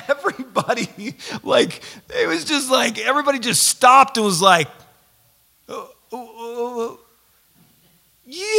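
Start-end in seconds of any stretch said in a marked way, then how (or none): 5.7-8.5 dawning realization. Retold or imitated. Crowd realizing, retold.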